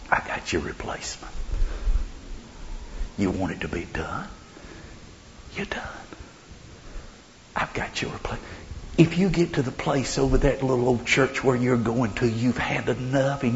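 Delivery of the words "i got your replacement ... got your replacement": soft, hushed near-whisper. Acted. God telling Elijah he's replaced, whispering. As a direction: deliver the news very quietly. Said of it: "he's still whispering".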